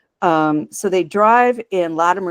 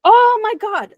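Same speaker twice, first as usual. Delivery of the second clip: high, loud, amazed exclamation; real astonishment; 5 dB louder than usual. Real, not acted. Co-host learning he played Blofeld, astonished. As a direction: blurt it out high, truly astonished.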